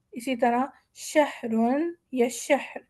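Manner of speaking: slow شہرن then شہر stopped short; two endings. Shown as a demonstration. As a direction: show شہرن with tanween, then شہر with jazm. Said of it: "ہمارے پاس دو options ہے".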